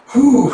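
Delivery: high, loud exhaled whew; relieved whew. Retold or imitated. Housemaster relieved at student's upset, big whew. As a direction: let out a big mock sigh of relief. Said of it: "just letting out a".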